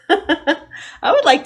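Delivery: laughing. Real, not acted. Teacher laughing as she tells kids her wish. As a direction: giggle your way through it. Laughing after chocolate comment.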